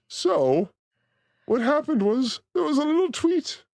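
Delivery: usual speaking voice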